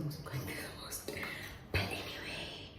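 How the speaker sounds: soft unvoiced whisper; library whisper. Real, not acted. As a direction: whisper so nobody wakes. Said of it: "I'm whispering because other people are still resting".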